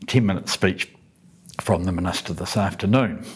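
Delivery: ordinary talk, nothing marked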